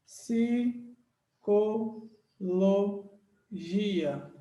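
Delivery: Psicologia said very slowly, a pause after each syllable; split into syllables. Shown as a demonstration. Teacher shows class how it is separated, syllable by syllable. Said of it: "é assim que se separa".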